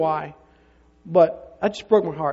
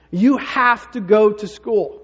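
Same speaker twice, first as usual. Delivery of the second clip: "you have to go to school" slow, louder, each word set down by the mother; a mother closing the argument, slow and loud; final stern ruling; 3 semitones higher, 5 dB louder, much slower than usual. Retold or imitated. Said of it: "Julie says to him".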